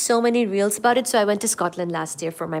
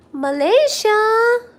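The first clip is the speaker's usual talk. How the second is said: excited; 13 semitones higher, 6 dB louder, much slower than usual. Real, not acted. Excited greeting to viewer's country.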